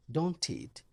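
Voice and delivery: ordinary delivery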